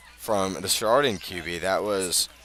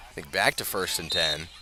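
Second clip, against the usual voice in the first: ordinary talk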